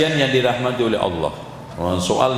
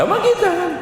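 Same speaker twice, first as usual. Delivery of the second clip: sudden loud exclamation; 4 dB louder, faster than usual. Acted. Preacher turning the point on his own audience, loud burst. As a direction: jump high and loud on this.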